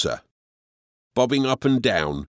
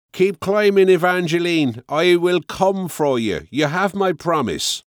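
higher, louder call of reassurance; father shouting; 7 semitones higher, 4 dB louder than usual. A narrator's reading. Father calling up to his daughter on the ladder, loud promise. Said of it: "Gerard shouted".

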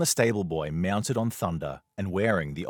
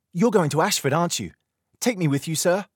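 put-on much higher, louder pleading boy; eager boy begging; 12 semitones higher, 7 dB louder than usual. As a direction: beg the knight to take you along. Boy begs to come along.

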